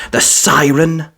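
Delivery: plain reading voice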